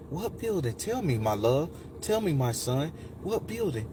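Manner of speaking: soft motherly coaxing; smooth, tender woman. Retold or imitated. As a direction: softly draw out an answer, imitating a loving woman. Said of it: "it was just the smoothest".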